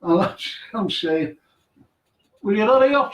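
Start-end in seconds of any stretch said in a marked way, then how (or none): 2.4-3.1 higher, louder urging; retold impatience. Nervous ghost hunter urges someone in the dark cell, impatient. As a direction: imitate hurrying someone along.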